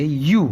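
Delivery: "یو" like English "you"; yoo pronunciation. Shown as a demonstration. Teacher showing ye with pesh, like English you. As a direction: say "یو" with y and long oo. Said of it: "جیسے English میں یو".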